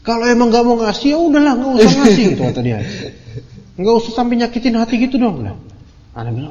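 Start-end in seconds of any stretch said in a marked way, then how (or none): 0.0-2.2 higher, quicker, imitating an offended beggar; mock indignant snap, raised pitch; retold anger. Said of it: "Marah dia". 3.8-5.5 higher and quick, imitating a hurt, offended man; mock aggrieved complaint; retold anger. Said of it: "Marah dia".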